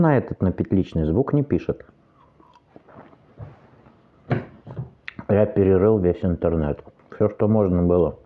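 speaking between bites; talking while chewing. Real, not acted. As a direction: mouth full of fruit. Seen 2: Fork brings watermelon to mouth; cheeks bulge chewing through the words.

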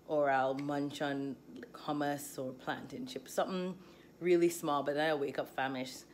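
normal talking voice

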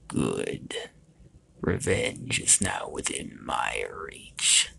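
very low, soft, slow villain voice; deep, hushed voice of a monstrous king on his throne; shadowy dark lord. A narrator's reading. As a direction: rumble it low and slow, savoring revenge. Large shadow king speaks from throne.

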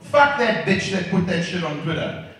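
ordinary delivery

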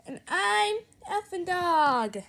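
usual speaking voice

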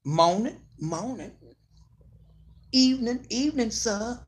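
high, proud neighbourly greetings; mock the stiff proud elder saying good morning; old-timey greetings. Host imitating elders greeting people on the street. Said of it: "When they used to do moaning".

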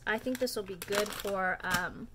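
ordinary delivery

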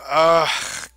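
normal talking voice

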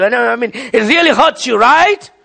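ordinary delivery

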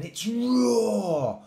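loud, high, drawn-out ROAR; demonstrated lion roar. A narrator's reading. Mummy Lion showing her cub how, big story-time roar. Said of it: "said Mummy Lion".

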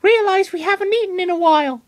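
high-pitched little Pokemon voice, sheepish; Lucario voice. Acted. Lucario admits to his trainer they are hungry, high-pitched. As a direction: squeak it out, pretending to be ashamed.

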